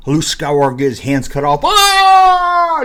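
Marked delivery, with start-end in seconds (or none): 1.6-2.8 high hammed-up scream of pain; mock scream. Imitating Luke screaming as his hand is cut off. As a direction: yelp like your hand was just cut off. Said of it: "He screams and everything".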